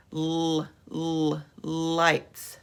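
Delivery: held "l" sounds before "lights"; beginning sound isolated. Shown as a demonstration. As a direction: hum a long L twice, then say "lights". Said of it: "what sound do you hear at the beginning".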